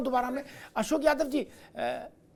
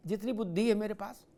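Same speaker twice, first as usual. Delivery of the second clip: usual speaking voice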